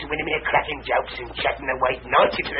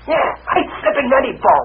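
higher, louder, pleased with himself; cheeky brag; 11 semitones higher, 6 dB louder than usual. Acted. Jerry bragging to his boss about the picture money, chirpy. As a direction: crow about the money you got. Boasting about the sale price.

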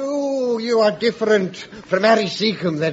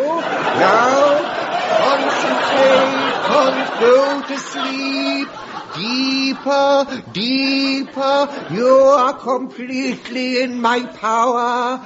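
slow, drawn-out hypnotic command; hammed-up stage hypnotist; 2 semitones higher, slower than usual. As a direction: lull her under, stretching the words. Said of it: "I'll show you my method".

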